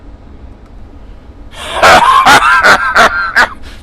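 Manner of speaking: loud, high barking laugh; roaring laugh. Acted. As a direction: roar with amused laughter. Kilrathi reacts to human's insult.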